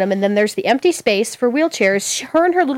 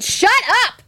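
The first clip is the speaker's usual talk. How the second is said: high, sudden exasperated yell; retold outburst; 10 semitones higher than usual. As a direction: re-enact snapping at a stranger. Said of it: "I finally just went".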